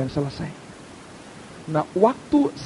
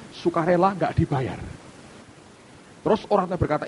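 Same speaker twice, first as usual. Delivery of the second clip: ordinary talk, nothing marked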